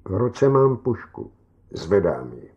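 plain reading voice

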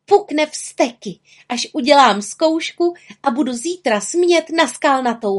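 high-pitched little witch, sure of herself; confident, high character voice; self-assured. A narrator's reading. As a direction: relish the coming triumph in a high voice. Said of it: "Byla si svou věcí jistá".

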